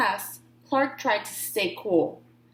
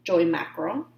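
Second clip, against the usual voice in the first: ordinary delivery, nothing marked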